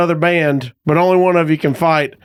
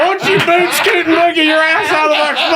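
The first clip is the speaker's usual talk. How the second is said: hammed-up cowboy shout; 11 semitones higher than usual. Ribbing the storyteller with a cowboy holler, laughing. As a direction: holler it like a line-dance caller. Mocking the cowboy parking confrontation.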